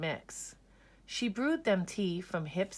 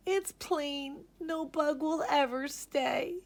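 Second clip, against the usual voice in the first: high, mildly sad lament; sad sigh; 9 semitones higher than usual. A narrator's reading. Miss Spider alone at her tea, mildly sad sigh. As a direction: sigh it out in sorrow. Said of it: "sadly sighed".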